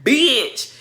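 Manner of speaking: high, loud, mocking insult tirade with a laugh. Retelling a rival's insults to the viewers in a high mocking voice. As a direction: ham up the rival's string of insults. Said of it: "she called K Michelle everything".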